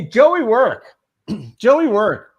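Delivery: loud, high hail to a colleague; calling out. Real, not acted. Host summons co-host for his picks, calling out loudly. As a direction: call the name out to get his attention. Calls on co-host Joey to answer.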